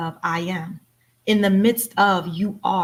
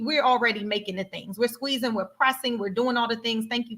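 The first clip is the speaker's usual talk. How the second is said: usual speaking voice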